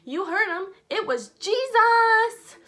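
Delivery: loud, drawn-out Jesus; emphatic healed man. Acted. Healed man backing up the apostles, loud and drawn-out. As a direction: declare it triumphantly. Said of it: "The paralyzed man's like".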